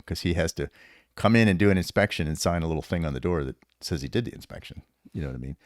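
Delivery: ordinary talk, nothing marked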